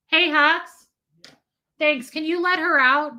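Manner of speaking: calling out. Real, not acted. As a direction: call to someone off mic with a request. Calls to someone off camera.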